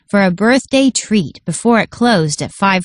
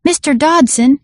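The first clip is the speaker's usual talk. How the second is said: higher, eager exclamation; the Booker greets him excitedly, calling out higher; 6 semitones higher than usual. A narrator's reading. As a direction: call his name with delight. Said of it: "exclaimed a breathless voice".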